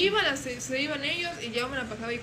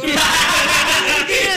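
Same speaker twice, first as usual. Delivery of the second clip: drawn-out, very loud, high cry with laughter; exasperated mock wail; 5 semitones higher, 15 dB louder, much slower than usual. Babysitter re-enacting her plea to a crying baby, hammed-up loud wail. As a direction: wail it out slowly in comic despair.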